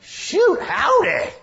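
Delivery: high, loud hammed-up yelp of surprise; mock folksy exclamation. Preacher mocking a careless cry, high and loud. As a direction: squawk it like a startled country boy. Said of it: "not just an exclamation like".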